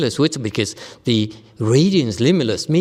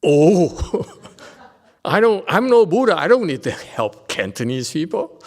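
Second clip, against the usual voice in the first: higher, louder, laughing as a defensive listener; mock protesting listener; 5 semitones higher, 3 dB louder than usual. Teacher voicing a student who refuses to help Cantonese people, laughing mock protest. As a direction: play the offended student brushing it off.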